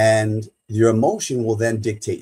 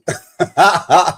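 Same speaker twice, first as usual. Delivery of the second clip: high, loud burst of laughter; 9 semitones higher, 10 dB louder than usual. Real, not acted. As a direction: laugh out loud at your own joke. Laughs at own joke.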